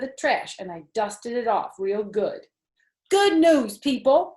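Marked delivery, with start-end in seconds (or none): 3.1-4.3 loud, high announcing shout. A narrator's reading. Junie B shouts her news to the whole family. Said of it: "I shouted real loud".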